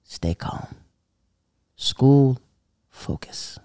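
hushed whispered advice. Retold or imitated. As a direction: whisper it like a stern secret. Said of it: "he's all like".

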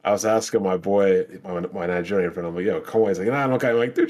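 ordinary talk, nothing marked

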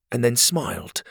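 ordinary delivery

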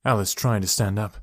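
ordinary delivery, nothing marked

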